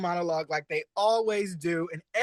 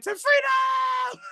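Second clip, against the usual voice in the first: high, loud, slow rallying shout of To freedom; mock battle cry; 5 dB louder, much slower than usual. Imitating the Snatch Game character's rallying cry. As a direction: bellow it like a freedom fighter. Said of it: "When this bitch said".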